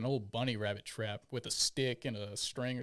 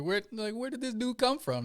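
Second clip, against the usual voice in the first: much higher, louder startled question; startled recruit; 12 semitones higher, 4 dB louder than usual. Retold or imitated. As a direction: jump out of your skin asking it. Startled by sneaking instructor.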